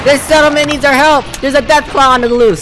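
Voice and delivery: militiaman sounding the alarm, then reassuring; loud, high call settling into heroic assurance; Preston Garvey. Acted. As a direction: arrive as the rescuing cavalry. Plays Preston Garvey to the rescue.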